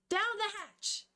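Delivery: high-pitched mock-cheery exclamation; hammed-up gusto. Denji about to swallow a cigarette, hammed-up gusto. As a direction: swoop up high like a playful toast.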